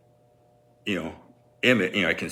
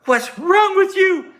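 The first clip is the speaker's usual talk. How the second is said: high, slow, loud disgusted mom voice; 7 dB louder, slower than usual. Retold or imitated. Mom disgusted with her son, high slow disgusted voice. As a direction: recoil in disgust at your kid. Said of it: "She just looks at me like".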